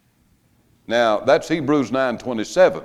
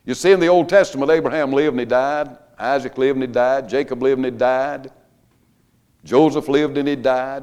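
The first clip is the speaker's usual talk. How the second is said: louder, faster rhythmic preaching; preaching at full force; 4 semitones higher, 5 dB louder than usual. Acted. Preacher to congregation, cadenced sermon climax, louder and driving. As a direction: drive each name home like a sermon.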